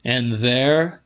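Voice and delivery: ordinary delivery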